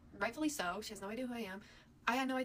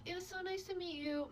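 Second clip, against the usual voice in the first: higher-pitched, sweet mom voice; mock-pleasant, raised-pitch goodbye; imitated polite goodbye; 7 semitones higher, 4 dB quieter than usual. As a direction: say farewell as a perfectly fine hostess. Mom's cheerful goodbye, acted out.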